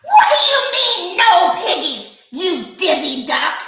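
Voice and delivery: high, loud screech; screeching witch. A narrator's reading. Witch screeching at the dizzy duck. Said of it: "Grinch screeched".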